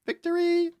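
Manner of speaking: extremely high squeal; comic squeaky victory cry; squeaked falsetto. Pretend. Squeaks the word, calls it squeak.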